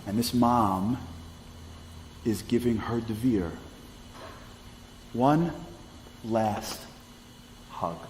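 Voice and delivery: long pause before each of one, last, hug; dramatic pauses. A narrator's reading. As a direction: let each word land alone. Climax of the story.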